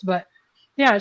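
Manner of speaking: ordinary talk, nothing marked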